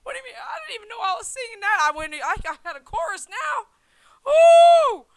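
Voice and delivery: loud, high, flustered; excited embarrassment. Real, not acted. Reacting to viewers about his old song, loud and high. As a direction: gush in disbelief.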